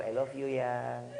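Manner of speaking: low, soft, drawn-out endearment; sweet-talking wife. Acted. Wife saying I love you at bedtime, sweet and low. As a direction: coo it low and softly, playing the loving wife. Acting an affectionate smiling wife.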